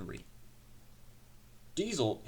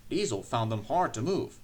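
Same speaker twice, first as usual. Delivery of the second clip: usual speaking voice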